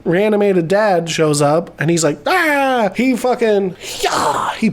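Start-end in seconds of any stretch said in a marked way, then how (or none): 2.3-2.9 high yelling cry; a lightly voiced charging father; hammed-up roar as the undead dad attacks; mock monster yell.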